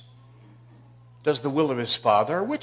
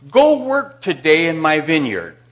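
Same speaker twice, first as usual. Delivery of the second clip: father's command; 7 dB louder, slower than usual. Acted. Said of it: "and he says to him".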